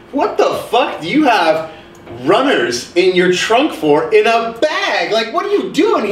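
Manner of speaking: high, incredulous exclamation; shocked outburst. Retold or imitated. Retelling finding runners in a friend's trunk, shocked high exclamation. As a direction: act stunned at what's in the trunk. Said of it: "I'm like".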